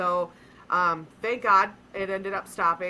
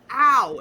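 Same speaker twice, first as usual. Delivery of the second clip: sudden loud mock ow of pain; louder quick ow of demo pain; 4 semitones higher, 9 dB louder than usual. Shown as a demonstration. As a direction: yelp like you just hit your funny bone. Acting out funny-bone pain.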